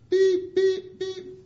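high, loud electronic beeps; imitated reversing beep. Preacher backing in, imitated warning beeps. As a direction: mimic a truck's backup beeper on one high note.

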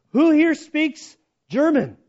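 loud, high, slow demand; angry guard. Retold or imitated. German guard busts in on the prisoners, angry shout. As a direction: call it out like a guard to the barracks. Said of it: "the German guy's all angry".

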